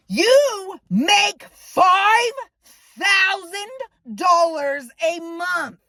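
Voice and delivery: five thousand dragged out high and slow; slow outraged stress. Real, not acted. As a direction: lean on every word in outrage. Furious disbelief at roommate.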